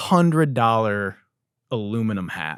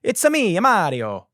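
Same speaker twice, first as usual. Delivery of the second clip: Mario impression; 9 semitones higher, 4 dB louder than usual. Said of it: "you know how he goes".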